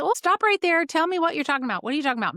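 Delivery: high, loud interruption easing down; mock disbelief. Practitioner stopping patient mid-story at news of mucus, taken aback. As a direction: cut in, astonished at what you just heard.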